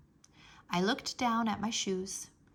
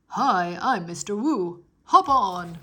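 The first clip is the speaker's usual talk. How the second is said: louder, hearty driver greeting; cheery bus driver; 9 dB louder, slower than usual. A narrator's reading. Bus driver Mister Wu greets the nervous girl, loud and hearty. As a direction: boom out a friendly welcome.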